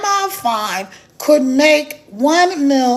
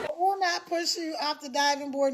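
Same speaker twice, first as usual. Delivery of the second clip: quiet; 9 dB quieter than usual. Real, not acted. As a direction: say it softly. Repeated quietly.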